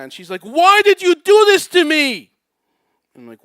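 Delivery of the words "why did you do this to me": imitating an upset woman, high full-volume wail; screaming reproach. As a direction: scream it down the phone, words stretched out. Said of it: "she's screaming at me".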